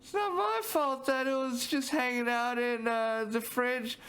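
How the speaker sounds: high-voiced excuse, slowing on the fridge; high, defensive mock sulk; high defensive whine. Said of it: "let me get a good story going".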